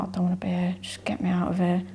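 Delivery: hushed, pleading to escape the ring; defeated whisper. Retold or imitated. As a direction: drop to a near-whisper, wanting out. Being counted by referee.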